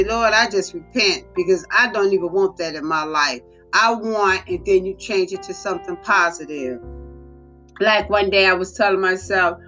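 ordinary talk, nothing marked

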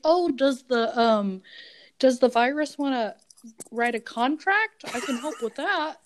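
lightly acted eager helper; mock helpful offer. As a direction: offer useless help in a lifted voice. Joking meek offer of useless skills.

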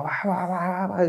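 high mock babble of venting; imitated tearful outburst. Imitating himself letting loose at the tribuna, mock tearful babble. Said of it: "y llore, y llore".

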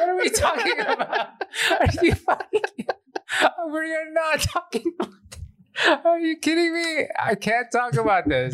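squealing high through helpless laughter; laughing so hard it nearly turns to tears; laughing hysterically. Real, not acted. Embarrassed on the podcast, laughing so hard he is nearly crying. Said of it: "Oh, I'm crying".

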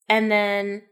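usual speaking voice

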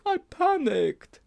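high-pitched sheepish schoolboy; panicked Sponge. Acted. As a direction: wail the admission in a boy's voice. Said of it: "said Sponge".

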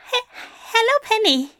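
high, stumbling greeting; tripping over the first word; flustered stammer. Acted. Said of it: "he managed to say".

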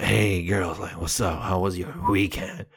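low, soft mock-gravel greeting; Hank Moody voice. Teenage him greeting classmates in a low mock-gravel voice. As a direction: greet the girls in a low, mock-cool rasp. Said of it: "And I'm like".

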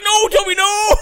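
very high, very loud cry; re-enacted alarmed dog scolding; yelling at the dog. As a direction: shout it as the desperate owner. Owner yelling at returning dog.

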